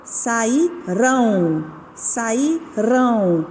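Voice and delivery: slow "sairão" with the final RÃO leaned on; stressed RÃO. Shown as a demonstration. Teacher to pupils, slowly stressing the last syllable. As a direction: draw out "sairão", weight on RÃO. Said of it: "qual que é a, a sílaba forte?".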